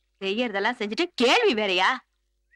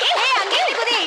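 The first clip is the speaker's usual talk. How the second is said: high, excited call to jump; urging call; 10 semitones higher than usual. Acted. Girl urges her frightened friend to jump, excited call. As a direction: call out high, egging them on.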